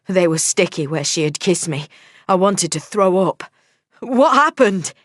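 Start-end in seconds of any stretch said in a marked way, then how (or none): 4.0-4.9 high, loud panicked cry; panicked yell at the sobbing woman; panicked shout. A narrator's reading. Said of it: "I shouted in panic".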